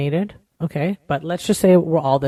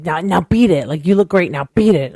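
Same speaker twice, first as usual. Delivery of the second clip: louder mock dismissal, a hint of Tommy; imitating Tommy; 7 dB louder than usual. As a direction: toss it off like a light brush-off, louder. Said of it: "something, like, Tommy would say".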